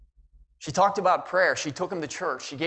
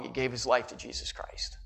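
hushed whisper; 7 semitones lower, 8 dB quieter than usual. Real, not acted. Touching moment in testimony.

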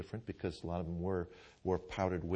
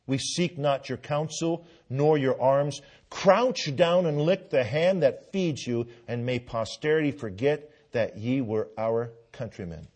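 Samuel Adams quote; 3 semitones higher, 8 dB louder than usual. Retold or imitated. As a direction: thunder it like a patriot shaming the waverers. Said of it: "He said".

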